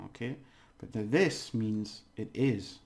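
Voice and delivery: ordinary talk, nothing marked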